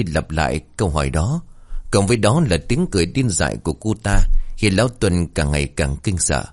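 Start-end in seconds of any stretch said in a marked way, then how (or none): none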